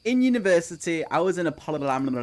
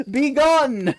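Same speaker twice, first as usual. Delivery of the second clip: hammed-up cry of be gone, higher and louder; mock banishing shout; 9 semitones higher, 8 dB louder than usual. Banishing the cucumber, mock exorcist shout. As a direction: cast the cucumber out like a priest.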